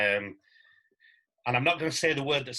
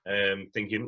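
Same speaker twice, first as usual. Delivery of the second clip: ordinary talk, nothing marked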